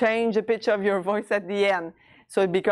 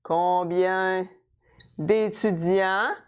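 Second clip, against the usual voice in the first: slow, stretched words with a pause between; drawn-out teaching delivery, chunk by chunk; piece by piece; much slower than usual. Shown as a demonstration. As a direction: build the question slowly for learners. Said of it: "I'm gonna start with".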